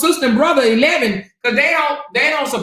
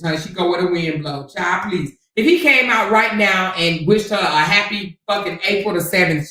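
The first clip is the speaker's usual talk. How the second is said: ordinary delivery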